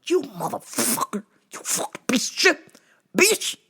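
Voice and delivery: high, squeezed cursing; strained mock fury. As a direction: curse through a tight throat, half-voiced. Ready to yank caller off phone.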